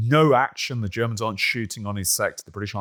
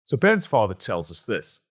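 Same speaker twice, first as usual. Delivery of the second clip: ordinary delivery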